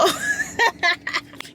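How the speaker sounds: nervous laugh. Real, not acted. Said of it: "whenever I laugh ... nervous response".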